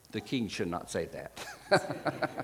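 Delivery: chuckling through a quoted rebuke; laughing. Retold or imitated. Amused quoting of Jehoshaphat's reply.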